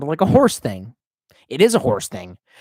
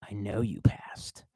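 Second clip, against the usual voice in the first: breathy whisper, barely voiced; hushed reassurance; 7 semitones lower, 12 dB quieter, slower than usual. Real, not acted. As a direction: whisper it like a private aside. Whispered correction to chat.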